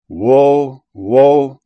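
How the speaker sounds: loud, drawn-out cries of warning. A narrator's reading. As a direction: proclaim each word loudly, like a herald. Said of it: "saying with a loud voice".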